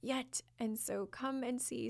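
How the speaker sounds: hushed, lilting invitation; voice of the chakra. Acted. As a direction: softly beckon the listener to explore. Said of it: "it's like".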